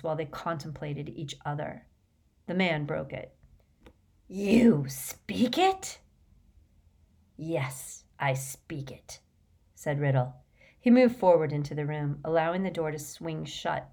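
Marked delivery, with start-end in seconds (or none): 4.3-6.0 slow, higher, rising disbelief; astonished question. A narrator's reading. Man astonished Riddle speaks Parseltongue. 7.4-9.2 low, soft, slow reply; quiet calm answer. A narrator's reading. Riddle calmly answers the astonished man.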